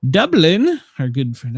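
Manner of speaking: a hammed-up Irish lilt, pitch jumping up on Dublin; mock Irish accent, deliberately overdone; bad Irish accent. Shown as a demonstration. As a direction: put on a clumsy stage-Irish voice. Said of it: "that was a horrible accent".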